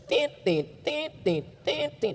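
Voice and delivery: clipped soft beeps of a hospital monitor; soft imitated heart-monitor beeps; short monitor beeps. Shown as a demonstration. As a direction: tap out broken machine beeps. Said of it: "Koma itu bunyinya belum panjang, masih ada putus-putusnya".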